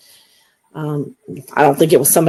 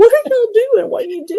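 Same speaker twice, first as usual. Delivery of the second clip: much higher, incredulous squeak; mock disbelief at the strange voices; baffled; 4 dB louder, faster than usual. Asking the voices what they're doing, incredulous. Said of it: "it's like".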